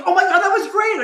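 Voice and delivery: very high mock squeal of praise. Tutor squealing over his cast, very high mock gush. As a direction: squeal it like an overexcited fan.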